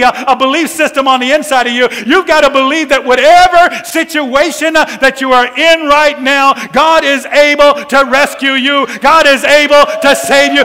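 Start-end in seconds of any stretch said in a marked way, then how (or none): 3.8-10.6 growing louder preacher's call; building preaching. Acted. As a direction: start lifting the congregation. Building sermon climax.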